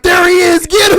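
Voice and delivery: loud, high, hammed-up attack cry; ambush shout. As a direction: yell it like hidden attackers charging out. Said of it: "they're just gonna be in the bushes like".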